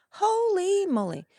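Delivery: hammed-up wonder; mock amazement. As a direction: marvel with a drawn-out holy moly. Exaggerated amazement.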